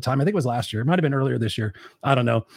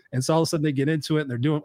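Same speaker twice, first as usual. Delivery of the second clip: ordinary talk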